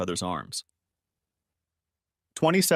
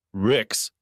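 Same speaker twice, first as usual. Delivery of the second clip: ordinary delivery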